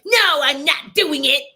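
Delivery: loud, high mock outburst, drawn out; biting heads off. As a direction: snap back in an exaggerated, forceful refusal. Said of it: "you're gonna bite people's heads off".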